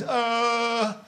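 long, high, held "uh"; mock a dim shop assistant stalling; clueless teen's drawl. Teenage shop assistant stalling at a customer, clueless drawl.

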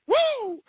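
high-pitched retold "woo!" of a goal scorer; celebratory whoop. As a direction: whoop like you just scored. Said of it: "she scored and went".

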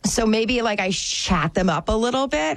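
ordinary talk

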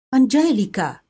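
cried out. A narrator's reading. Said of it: "gridò la figlia maggiore".

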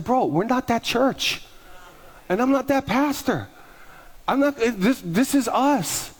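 slow, high, deliberate words; firm emphatic. Retold or imitated. Pastor telling a critic his church isn't that, slow and firm. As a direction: lay it down slowly and firmly.

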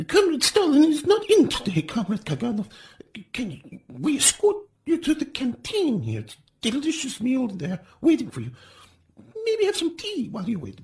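frightened official steering the intruder to tea. A narrator's reading. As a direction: coax him away, oily and eager. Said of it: "in a less sniveling voice".

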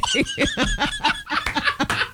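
squealing laugh, high and breathless; a real high-pitched laugh; high burst of laughter. Real, not acted. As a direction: crack up high. Amused at dad's remark.

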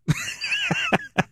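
soft, very high-pitched laugh. Real, not acted. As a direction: let out a small high laugh. Laughs at the cutting remark.

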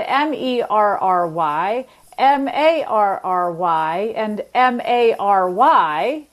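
letters spelled slowly one by one; slow spelling out. Shown as a demonstration. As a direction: spell each word out carefully. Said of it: "three different vowels".

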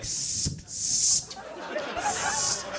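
unvoiced hiss like a leak; hissing fart. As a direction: hiss softly through the teeth. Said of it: "It's like your ass is whispering".

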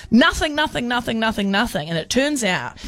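ordinary talk, nothing marked

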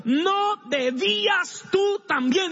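high, loud, slow challenge; king confronting the unforgiving servant; king's stern question. Acted. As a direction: lean hard on "tú". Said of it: "quiero que repita eso fuerte".

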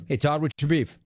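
ordinary talk

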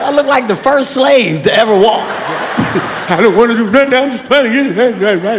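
timid pleading excuse; meek protest. Retold or imitated. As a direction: cower and protest meekly. Cowering man protesting meekly.

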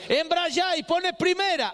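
slow, high bossy father; hammed-up shouted instruction; imitating a scolding dad; high mock order. Father's barked order retold.

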